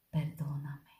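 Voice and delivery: a hushed, breathless whisper; whispered plea. Retold or imitated. Retelling her prayer to the Lord, whispering for forgiveness. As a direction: barely voice the word, pleading softly.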